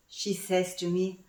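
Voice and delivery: ordinary talk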